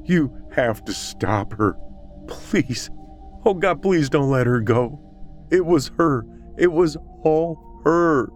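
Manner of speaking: high, anguished begging that builds; desperate pleading. A narrator's reading. Suspect begs police not to let her go, desperate. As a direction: beg them, pitch climbing as you go.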